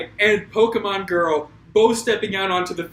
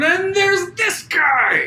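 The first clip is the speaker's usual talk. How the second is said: slow, loud hype; excited shout; 9 semitones higher, 4 dB louder, slower than usual. Real, not acted.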